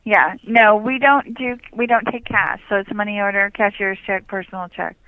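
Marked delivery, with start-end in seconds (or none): none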